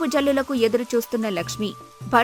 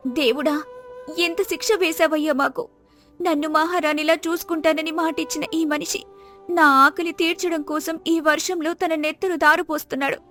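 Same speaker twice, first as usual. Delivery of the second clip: higher-pitched, anguished woman's voice; grieving poor wife; 4 semitones higher than usual. Acted. Wife pleading with God over her suffering husband, grieving. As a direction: lament to God on a high, sustained pitch.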